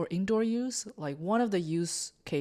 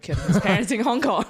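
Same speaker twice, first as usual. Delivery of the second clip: louder, breaking into laughter while talking; laughing; 3 semitones higher, 8 dB louder than usual. Real, not acted. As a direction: barely get it out through laughter. Laughs while telling grim story.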